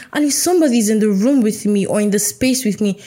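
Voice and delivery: normal talking voice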